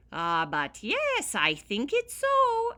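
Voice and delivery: slow, loud and rising high, firm; firmly. Acted. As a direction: insist firmly and slowly. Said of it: "answered the leprechaun firmly".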